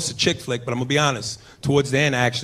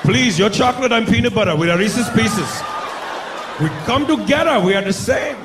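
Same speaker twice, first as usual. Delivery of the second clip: hammed-up frantic Indian-accented pleading; pleading Indian man; 5 semitones higher than usual. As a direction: beg for mercy in an Indian accent. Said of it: "pull a gun out to rob 'em".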